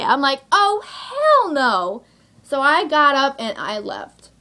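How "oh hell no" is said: high, long drawn-out sassy refusal; outraged refusal. Retold or imitated. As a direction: stretch every word, high and defiant. Acted refusal to pay.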